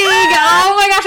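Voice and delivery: high, slow, excited oh my gosh; excited gasp. Real, not acted. Fan reacting to the lovers being caught, excited. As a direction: gush in real excitement.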